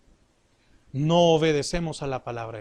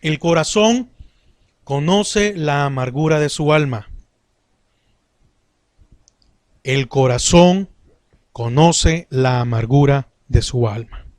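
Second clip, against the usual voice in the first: loud, emphatic verse reading; loud, deliberate Bible recitation, repeated for weight; scripture reading; 2 semitones lower, 8 dB louder than usual. Acted. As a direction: proclaim the verse firmly, word by word. Reads the Bible verse aloud.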